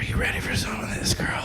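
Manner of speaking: breathy seductive whisper. Retold or imitated. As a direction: whisper it into her ear. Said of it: "And I whisper in Mom's ear".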